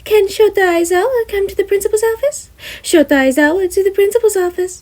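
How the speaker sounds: high announcement voice; intercom call. Acted. Nezu paging Aizawa over intercom, announcer style. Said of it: "leans into some sort of stick".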